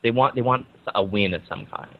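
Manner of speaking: normal talking voice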